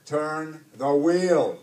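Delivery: slow, loud, drawn-out roar. A narrator's reading. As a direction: roar each word slowly as a final order. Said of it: "Hook roared".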